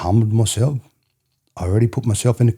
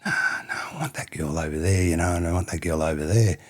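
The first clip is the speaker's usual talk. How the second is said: softer, hushed retold pleading; mock wanting; 3 semitones lower, 7 dB quieter than usual. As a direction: murmur it like a boy pining for a girl. Retelling youthful wanting.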